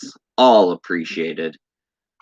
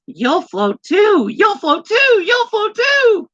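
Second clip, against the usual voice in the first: very high, louder creepy clown sing-song; 4 dB louder than usual. Acted. Streamer reading a horror-movie quote, in a very high creepy clown voice. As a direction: taunt like Pennywise the clown. Quoting Pennywise line in voice.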